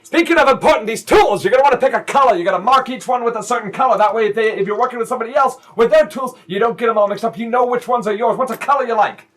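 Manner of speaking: high, bright-voiced eager dad; upbeat, eager to bond; chipper stepdad. Cheerful stepdad about tools.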